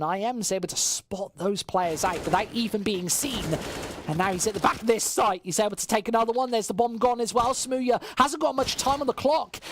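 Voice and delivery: excited commentary, climbing with the action then settling; live play-by-play. Acted. Caster following a lone player's clutch, excited play-by-play. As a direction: call the clutch attempt with mounting excitement.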